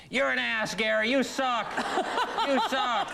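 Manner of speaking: high-pitched hollered insults; imitated heckling yell. Spurned homeless fan heckles comedian down the street, mock yell. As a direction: mimic a heckler calling down the street. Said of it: "this homeless guy across the street sitting on a park bench yells".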